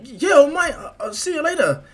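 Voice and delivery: very high, loud, stammering fanboy; Izuku. Acted. Boy waving off his idol, high and flustered. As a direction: squeak a flustered goodbye. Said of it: "And Izuku's like".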